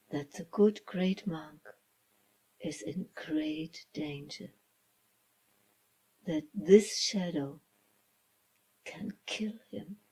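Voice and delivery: ordinary delivery, nothing marked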